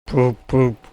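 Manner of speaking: high, loud mock whistle; imitated engine whistle. A narrator's reading. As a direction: toot like a big engine. Gordon whistles back.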